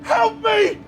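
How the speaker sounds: high, loud panicked yells; fleeing goblin screaming for help; cry for help. Acted. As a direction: yell for help while fleeing. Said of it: "he sprints up and he goes".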